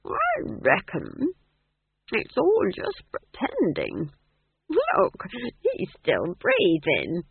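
put-on slow, high-pitched old witch; ponderous musing in a high character voice; slowly. A narrator's reading. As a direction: reason it out at a drawn-out pace. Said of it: "she said slowly".

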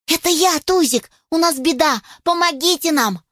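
high-pitched, alarmed puppy plea; urgent puppy. Acted. Puppy tells old grouse of trouble, urgent. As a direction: cry for help in a puppy voice.

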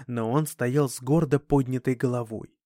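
ordinary delivery, nothing marked

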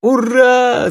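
high, loud cheering "Ura"; joyful yell. A narrator's reading. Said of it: "завопил Клубок".

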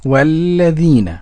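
"والذين" loud and slow with held lam; careful model repetition. Shown as a demonstration. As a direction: repeat "والذين" firmly, stretching the long vowel. Host repeats the modelled word. ("والذين" بصوت واضح متأن)

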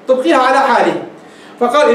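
ordinary talk

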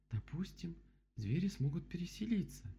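high-pitched storybook bear; voiced bear character. A narrator's reading. Bear looks over the dam, high character voice.

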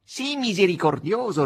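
high-pitched protesting cat; pleading cat. Acted. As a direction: beg for mercy as a theatrical cat.

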